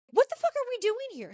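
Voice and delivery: high-pitched retold outburst; mock exasperation. Mary turns around on the group, mock exasperated.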